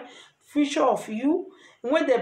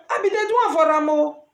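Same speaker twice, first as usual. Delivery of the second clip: usual speaking voice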